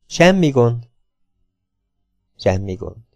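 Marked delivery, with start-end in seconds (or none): 2.4-3.1 softer, lower murmured repeat, half to himself; quiet repeat. A narrator's reading. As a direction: let the second one sink down softer and lower. Sam reassures Lilla twice.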